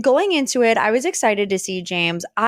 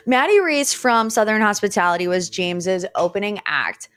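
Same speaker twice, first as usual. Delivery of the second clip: ordinary talk, nothing marked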